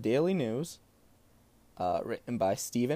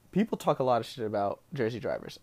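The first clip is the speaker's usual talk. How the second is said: ordinary delivery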